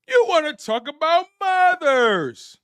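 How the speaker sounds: mock-offended challenge to the chat; mock outrage. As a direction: puff up like you're offended, hammed up.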